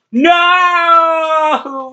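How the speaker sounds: high, long-drawn "no"; mock despairing shout. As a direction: cry out as if thrown from the match. Wrestler eliminated in play match.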